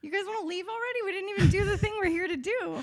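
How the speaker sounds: high, incredulous whine; hammed-up disappointed pleading; mock protest. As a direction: object in a hurried, high voice. Acted protest at leaving early.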